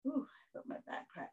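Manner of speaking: breathy whisper. Real, not acted. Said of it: "unvoiced exclamation".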